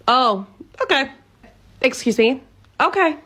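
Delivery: taken aback. Acted. Stunned at being fired.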